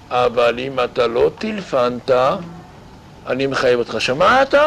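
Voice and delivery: normal talking voice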